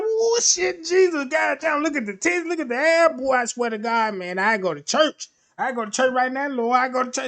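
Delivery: high, loud hollering praise; excited hollering. Retold or imitated. A man's mind eyeing the woman, loud excited hollering. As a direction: holler like he's struck by the Lord. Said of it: "His whole mind is talking like this".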